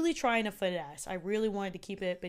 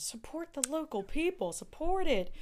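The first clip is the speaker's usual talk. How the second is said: cheerleading; 7 semitones higher than usual. Acted. Rallying support for local farmers.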